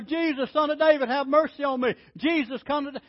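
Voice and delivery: high, loud plea for mercy; crying out. Retold or imitated. Blind beggar calling to Jesus by the road, loud and pleading. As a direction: call out desperately across the road. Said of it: "he began to cry out".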